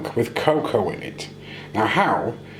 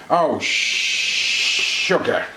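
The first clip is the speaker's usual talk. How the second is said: mock swear; 4 semitones higher, 3 dB louder, much slower than usual. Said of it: "the Russian equivalent of us saying".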